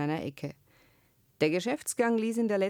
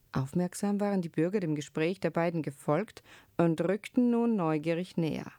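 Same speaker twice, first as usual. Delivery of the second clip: usual speaking voice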